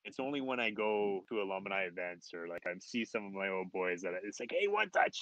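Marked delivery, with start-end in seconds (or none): 4.5-5.2 retold greeting, pitched high; calling out. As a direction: call an old friend by nickname. Old pals calling the nickname.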